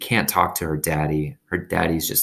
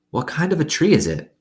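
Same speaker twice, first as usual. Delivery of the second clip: put-on higher curious girl voice; a little girl asking, higher; young girl voice; 9 semitones higher than usual. A narrator's reading. Opal asks in story.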